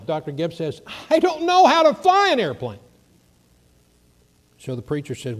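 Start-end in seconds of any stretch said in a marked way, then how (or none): 1.1-2.8 high, loud panicked protest; panicked cry. Retold or imitated. Gibbs protesting to the preacher he can't fly, high panicked shout. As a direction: blurt it out in alarm, much higher.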